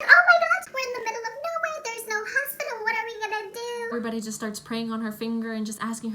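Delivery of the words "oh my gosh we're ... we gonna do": very high-pitched frantic imitation; panicking mom. Mom with no hospital nearby, imitated high panic. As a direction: start loud and shrieky, stay high and frantic.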